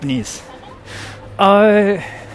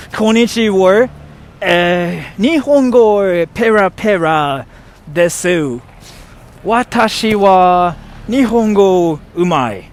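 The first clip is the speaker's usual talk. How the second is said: proud showy Japanese; 3 semitones higher, 5 dB louder, slower than usual. Pretend. Showing off her Japanese.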